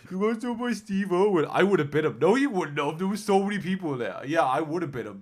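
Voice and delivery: high, loud cartoon squabble; bickering crocodile voices. Crocodiles mocking the one Steve Irwin jumped, loud teasing voices. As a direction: argue like two teasing crocodiles. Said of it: "I know we're giving them voices".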